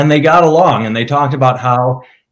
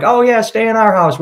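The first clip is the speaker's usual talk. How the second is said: high, sing-song mock of a friendly small-towner; mocking rural hosts who welcome the band, higher and sing-song; 9 semitones higher than usual. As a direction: put on a falsely sweet, higher host voice.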